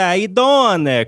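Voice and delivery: put-on drawled Chicago accent, slow. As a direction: stretch it out Chicago style. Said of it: "That's not a Chicago accent".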